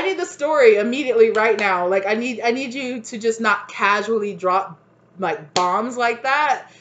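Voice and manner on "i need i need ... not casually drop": normal talking voice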